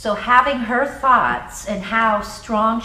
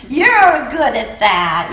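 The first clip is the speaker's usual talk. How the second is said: laughing; 4 semitones higher, 8 dB louder, much slower than usual. Real, not acted. Teacher laughs at student's remark.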